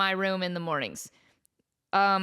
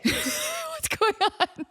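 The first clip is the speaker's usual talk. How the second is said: laughing high squeak; much slower than usual. Real, not acted. Laughing panic about memory.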